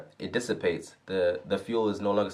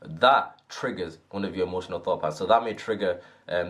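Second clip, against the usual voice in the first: ordinary delivery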